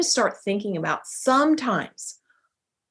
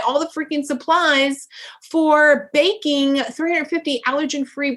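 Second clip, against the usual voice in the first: frustrated rant at herself; 3 semitones higher than usual. Retold or imitated. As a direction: vent like you're fed up with yourself. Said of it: "you're pissed at yourself and you're like".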